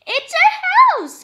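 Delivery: high, loud squeak; squeaky mouse. Acted. Mother mouse squeaking to George, high and loud.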